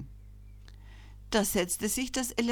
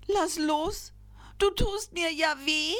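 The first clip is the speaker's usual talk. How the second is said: much higher, pained little elephant voice; pleading in pain; 9 semitones higher than usual. A narrator's reading. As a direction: beg to be let go, hurting. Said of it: "bat mit bebenden Nasenflügeln".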